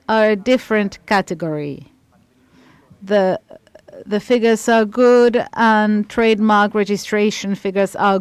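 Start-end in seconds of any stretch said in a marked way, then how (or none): none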